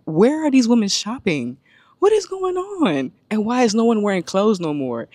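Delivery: high-pitched, incredulous questioning; retold disbelief. Woman recounting revealing outfits at a concert, in exaggerated disbelief. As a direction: act out baffled outrage, pitch leaping up. Retelling own shock at outfits.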